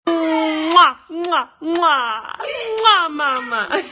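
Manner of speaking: smacking kisses into the phone; loud kissy smacks; between kisses. Real, not acted. Mom kissing kids goodbye on stream.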